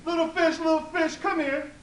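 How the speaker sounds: high, loud sing-song call; high calling voice to the water. Acted. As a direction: call the fish from the shore, pitched high. Old woman calling the fish.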